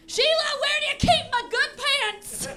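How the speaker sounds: put-on high, quavering old man calling his late wife; old widower. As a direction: call out to a lost wife, high and plaintive. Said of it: "dying to know".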